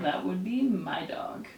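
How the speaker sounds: slow breathy whisper aside. Acted. Teacher whispering the answer to students, slow whisper. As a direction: let it slip out as a secret.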